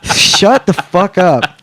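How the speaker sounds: high, drawn-out mock outburst; exasperated retort. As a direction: stretch it out in comic disbelief. Retort to intrusive hentai questions.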